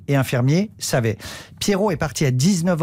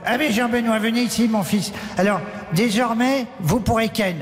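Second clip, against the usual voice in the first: put-on old cardinal speaking higher; a very old churchman announcing; old man voice; 5 semitones higher than usual. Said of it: "j'ai qu'une voix de vieux".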